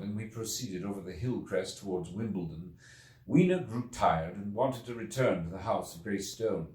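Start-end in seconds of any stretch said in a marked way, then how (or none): none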